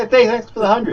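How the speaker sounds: mock friend. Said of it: "He's like".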